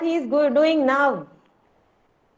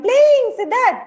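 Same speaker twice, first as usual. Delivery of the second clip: mock surprise; 11 semitones higher, 5 dB louder, much slower than usual. Real, not acted. Teacher echoes child's answer.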